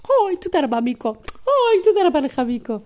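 high, loud swooping grandma voice; gushing thanks. Acted. Grandma kissing Miko on the cheek, gushing thanks. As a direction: swoop down from a high "oy" each time.

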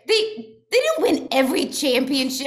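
high-pitched, real protest; incredulous objection. Real, not acted. Disputing a friend's claim that Boston won it all, incredulous. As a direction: object in disbelief, pitch jumping up.

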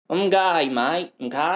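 high, loud mock Aussie twang; Australian accent. Host imitating Stav, exaggerated high Australian accent. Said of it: "good Australian accent".

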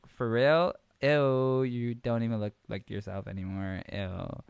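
disgusted mock Valley Girl; hammed-up Valley Girl ew. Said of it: "decided to go Valley Girl".

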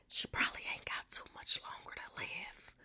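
guilty whisper; whispered guilty aside. Real, not acted. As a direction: whisper it like a secret. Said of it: "God forgive me".

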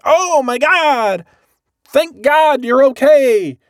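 very high, drawn-out mock relieved cry; hammed-up relief. Finding his friend safe on the roof, hammed-up relief.

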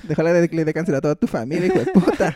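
rushed mock curse, laughing through it; insult through laughter. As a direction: spit the curse out fast while cracking up. Ego's insult, acted laughing.

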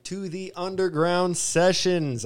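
high, slow showman intro; weird announcer voice. Real, not acted. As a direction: stretch it out like a show host. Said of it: "I don't know why I said that so weird".

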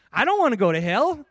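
high, loud insistence; adamant. Retold or imitated. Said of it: "like, adamant about this".

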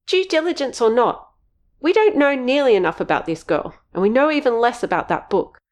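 high, insistent scolding; angry. Acted. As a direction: cut him off and push on. Missus J arguing.

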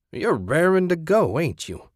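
high leering tease; teasing sleazy voice. Acted. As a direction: needle him with a sly grin. Jack teases Ted.

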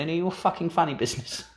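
snappy South African telling-off, lightly voiced; South African accent. Woman recounting scolding Daryl Hannah, South African accent. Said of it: "I could do a South African accent".

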